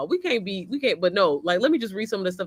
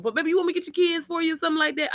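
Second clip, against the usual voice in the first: eager offer; 9 semitones higher, much faster than usual. Retold or imitated. Voicing herself offering help.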